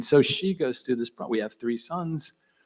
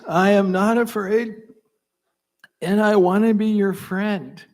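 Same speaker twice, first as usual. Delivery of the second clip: declaring loudly; 8 semitones higher, 8 dB louder than usual. Retold or imitated. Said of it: "My answer to this question is".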